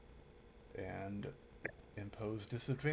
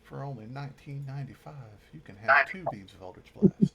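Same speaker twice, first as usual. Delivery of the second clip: infomercial pitchman; 4 semitones higher, 12 dB louder than usual. Retold or imitated. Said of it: "Billy Mays here for Eldritch Blast".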